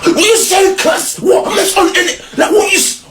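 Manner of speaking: high, loud street challenge yelled out; angry shout. Retold or imitated. Shouting down the rude bus driver, squaring up for a fight. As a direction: yell it like you're squaring up. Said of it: "that zone's already kicking in".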